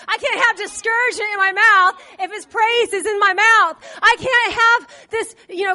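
loud, rising sermon preaching; preaching at full force. Acted. Preacher drives home praise over complaining, full-force preaching. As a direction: preach it to the room.

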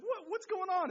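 startled mom at the door; surprised mom. Retold or imitated. Mom startled by the king at the door, mock surprise. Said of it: "your mom's like".

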